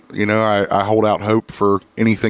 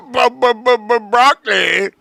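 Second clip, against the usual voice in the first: high-pitched mock stumble over the word; mocking imitation; 12 semitones higher than usual. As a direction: babble it high, teasing a co-host's slip. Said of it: "That's what you said last week".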